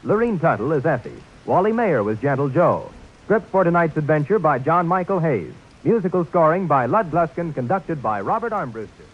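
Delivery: upbeat announcer credits. Acted. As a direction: read the credits crisply.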